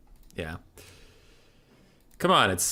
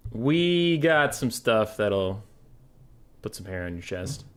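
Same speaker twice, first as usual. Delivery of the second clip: ordinary talk, nothing marked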